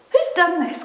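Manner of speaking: put-on high, loud outraged girl; acted storybook girl's outcry; distressed cry. A narrator's reading. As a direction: cry out in dismay. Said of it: "cried Susan".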